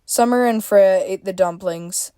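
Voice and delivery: ordinary delivery, nothing marked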